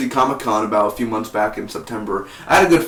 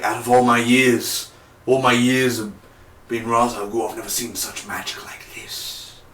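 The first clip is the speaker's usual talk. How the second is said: lightly put-on solemn villain, fading softer; Ra's al Ghul impression; 4 dB quieter than usual. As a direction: intone it like an ancient leader. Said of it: "and he's like".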